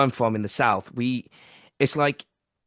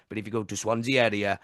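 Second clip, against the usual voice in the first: ordinary talk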